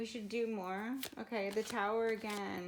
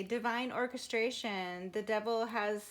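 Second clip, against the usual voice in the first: normal talking voice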